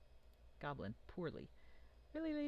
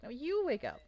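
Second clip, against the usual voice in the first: high, loud goblin grumble; a goblin shoving the next one awake, high and loud; grumpy goblin; 9 semitones higher, 7 dB louder than usual. Acted. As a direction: squeak it out as a cranky goblin. DM voices goblin waking another.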